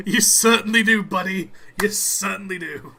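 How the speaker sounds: loud, high, amused reply; booming amused agreement. Real, not acted.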